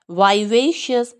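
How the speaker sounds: American accent, वाइवेशियस opening with vai; American vivacious. Shown as a demonstration. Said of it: "Americans क्या बोलते हैं". (American उच्चारण)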